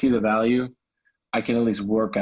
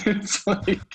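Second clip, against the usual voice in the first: higher-pitched, amused laugh through the words; 5 semitones higher than usual. Real, not acted. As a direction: let the laughter spill into the line. Laughing at own therapy remark.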